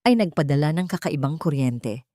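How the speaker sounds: usual speaking voice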